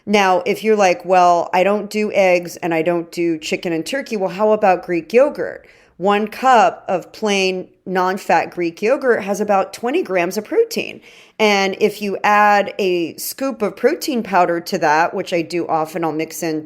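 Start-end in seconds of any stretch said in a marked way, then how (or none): none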